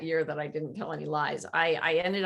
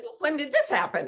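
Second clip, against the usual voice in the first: higher, louder, eager asking; astonished question; 7 semitones higher, 4 dB louder than usual. Retold or imitated. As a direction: press him for the date, amazed. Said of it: "And I said".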